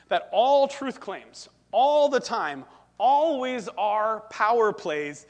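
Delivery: ALL and ALWAYS hit loud and drawn out; slow, loud weight on "all" and "always"; emphatic preaching. Real, not acted. Preacher hammering the absolute claim.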